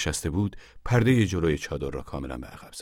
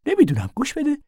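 much higher, louder urgent call; urgent thin man; 8 dB louder than usual. A narrator's reading. The thin man urging Casy outside, much higher and louder. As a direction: call him out fast, alarmed.

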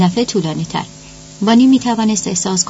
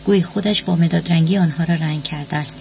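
plain reading voice

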